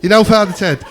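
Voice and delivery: usual speaking voice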